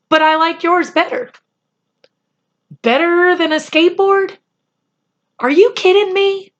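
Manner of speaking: put-on a kid who can't believe it; incredulous Patrick. A narrator's reading. Patrick doubting Omri's present, high boyish disbelief.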